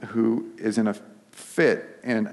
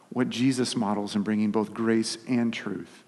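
usual speaking voice

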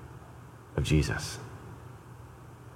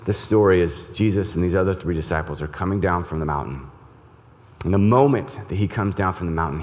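normal talking voice

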